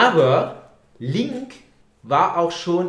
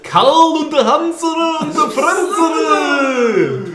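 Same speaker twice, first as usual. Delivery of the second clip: mock Japanese-style names; 13 semitones higher, 8 dB louder than usual. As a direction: belt out the silly names like a stadium announcer. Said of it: "Wir heißen ja auch alle Franz. Für die Japaner".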